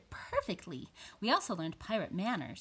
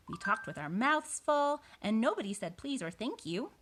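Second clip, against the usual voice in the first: animated, raised-pitch storybook narration; reading to children; 6 semitones higher than usual. A narrator's reading. Boy describes pirate table manners, animated narration. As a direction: read it with delighted emphasis. Reads picture book aloud.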